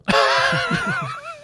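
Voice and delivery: shrieking cackle way up high; squealed high-pitched giggle; high squealing laugh. Acted. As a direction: let out a shrill giggle. Big high laugh in character.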